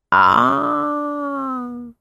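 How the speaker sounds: drawn-out mysterious fortune-teller. A narrator's reading. Fortune-teller voiced in story.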